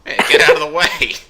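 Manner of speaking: high, loud yell to clear the road; mock shout. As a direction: holler it in a put-on voice. Voices a shout in the film.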